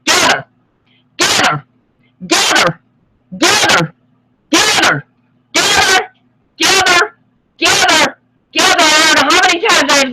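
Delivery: repeated high, loud command; shouting. Real, not acted. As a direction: call them in, loud and insistent. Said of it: "I'm gonna say it real simple".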